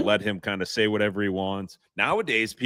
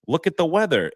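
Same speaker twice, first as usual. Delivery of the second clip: higher, louder quoted voice; imitated goalie; 9 semitones higher, 5 dB louder than usual. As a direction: put on the goalie's voice, pitched up. Imitating Brizzy's deadpan answer.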